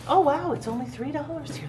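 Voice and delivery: pitched high, a mild mock wow; impressed exclamation. To the lady at the store, mildly delighted wow. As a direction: play up the surprise at the price, lightly. She retells her own reply.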